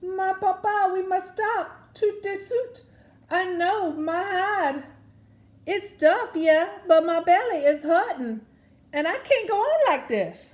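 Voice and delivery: high, loud pleading cry of a gator in pain; hollering alligator. Acted. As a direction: holler it out to Papa Noel, begging to stop. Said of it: "Edna hollered".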